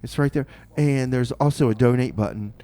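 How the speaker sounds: ordinary talk, nothing marked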